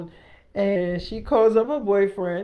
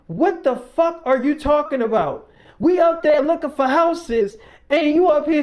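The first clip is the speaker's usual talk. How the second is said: loud, high, acted exasperation; mock-furious disbelief, raised voice; retold angry outburst; 7 semitones higher, 5 dB louder than usual. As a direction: argue back as an outraged boyfriend. Acted boyfriend exploding in quarrel.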